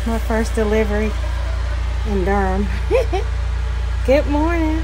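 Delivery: muffled behind cloth mask; speaking through cloth covering; through a face mask. Real, not acted. Seen 2: Red cloth mask covers mouth and nose throughout labelled words.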